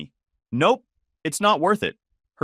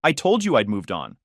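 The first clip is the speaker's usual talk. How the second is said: ordinary delivery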